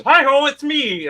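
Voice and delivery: imitating a cliché puppet voice; stock Henson puppet voice. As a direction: ham up the classic puppeteer hello. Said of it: "fall into those Henson voices".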